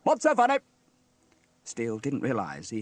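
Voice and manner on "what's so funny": high, loud indignant demand; indignant shout. A narrator's reading. Steele challenges his laughing crew, shouting. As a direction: shout it, affronted. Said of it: "shouted Steele".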